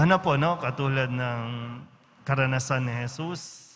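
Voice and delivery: ordinary talk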